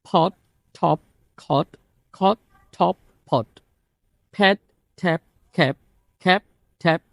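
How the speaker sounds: quick rapid drill of aspirated ফ, ঠ and খ, as in ফ্যান and ঠ্যান; crisp burst of air on every first sound. Shown as a demonstration. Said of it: "এই পাঁচটা sound আপনাকে ঠিক করার একটা ম্যাজিক".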